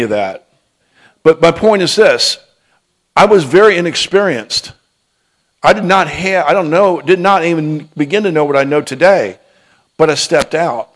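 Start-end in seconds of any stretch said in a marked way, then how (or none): 1.2-10.9 raised, louder preacher's voice; emphatic preaching. Real, not acted. Preacher admits inexperience to urge listeners on, emphatic preaching. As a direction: drive the point home, pitched high and strong. Preacher drives home the point.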